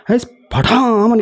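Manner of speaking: high, slow retold shout; half-acted sharp cry at the meditator; teacher barking as he strikes the student; mock sharp shout. Guru strikes, shouts at him.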